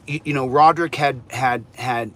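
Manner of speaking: ordinary delivery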